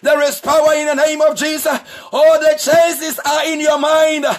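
ordinary talk, nothing marked

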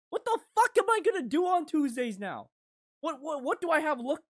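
ordinary talk

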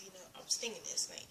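quick hushed whisper aside. Real, not acted. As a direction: drop to a fast whisper, like a secret. Confiding aside.

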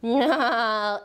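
ordinary delivery

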